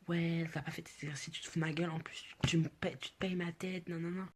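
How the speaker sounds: high-pitched imitation of an angry telling-off; put-on high, outraged scolding; mock furious teacher. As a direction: rant like an enraged teacher, pitched well up. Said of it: "il était noir de colère".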